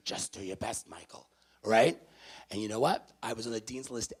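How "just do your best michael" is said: lightly voiced Bronx mom, soft and low; a hint of a mother's raspy Bronx murmur. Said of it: "I heard her raspy Bronx voice".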